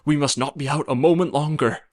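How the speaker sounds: a hint of a worried nurse, urgent; hurried nurse voice, pressing; anxious nurse. A narrator's reading. Nurse hurries the princess home, mildly urgent.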